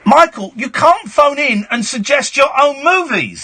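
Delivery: high-pitched indignant scolding; mock outrage. Host scolding the supposed film star, mock outrage. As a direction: tell off the big star, voice pitched right up.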